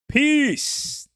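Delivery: very high, held farewell call; high hype holler; shouted sign-off. Real, not acted. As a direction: throw it out as a big goodbye. Shouted farewell to live viewers.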